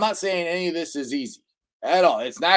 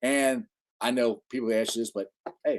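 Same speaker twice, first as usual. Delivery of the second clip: ordinary talk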